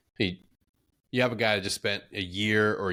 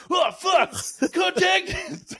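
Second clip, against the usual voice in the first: high, loud startled yell; hammed-up shout of alarm; mock panic yell; 5 dB louder, much slower than usual. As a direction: jump out of your skin at the gunfire. Retold panic at test fire.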